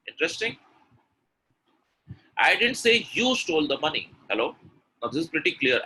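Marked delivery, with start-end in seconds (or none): 2.4-4.1 "you" lifted high and punched; emphatic "you", falling after; stress on you. Shown as a demonstration. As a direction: pick out "you" as the wrong person. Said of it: "the stress is on you".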